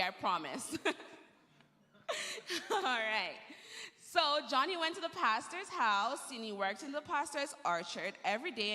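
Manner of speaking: normal talking voice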